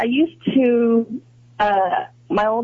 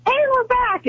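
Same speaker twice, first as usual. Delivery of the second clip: much higher sing-out of a show's return; imitated on-air call; 13 semitones higher than usual. Host retelling the sudden return from break, imitated high call. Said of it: "all of a sudden I hear".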